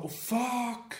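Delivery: sudden high alarmed curse; shocked outburst. Real, not acted. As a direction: blurt it out in shock. Suddenly remembers forgotten giveaway.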